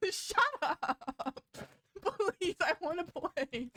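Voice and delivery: exasperated, giggly complaint; laughing protest. Real, not acted. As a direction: beg while cracking up. Stuck game, protesting through laughs.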